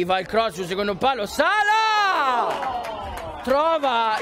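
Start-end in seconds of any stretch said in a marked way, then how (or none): 1.3-4.2 excited name call. Real, not acted. Header chance.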